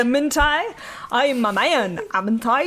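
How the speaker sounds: hammed-up, awful Scottish accent; bad Scottish accent. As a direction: do your worst Scottish brogue. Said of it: "that was the worst version of a Scottish accent".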